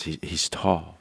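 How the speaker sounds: low, soft and breathy; sultry voice. Pretend. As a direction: purr it out, husky and slow. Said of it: "say that in your sultry voice".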